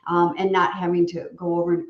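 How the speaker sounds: ordinary delivery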